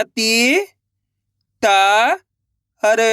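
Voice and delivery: long "ती", then "ता" and "हर" set apart by pauses; letter by letter. Shown as a demonstration. Teacher leads pupils to repeat, separate drawn-out "ती", "ता", "हर". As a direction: sound out "ती", "ता", "हर" slowly. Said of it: "मेरे साथ साथ इनको रिपीट करना है".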